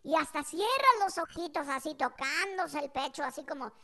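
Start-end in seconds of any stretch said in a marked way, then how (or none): 3.7-3.7 soft imitated sigh of relief; relieved sigh. Mother touching her chest, soft relieved sigh. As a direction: sigh it out like Mom unburdened. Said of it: "Como que siente un alivio".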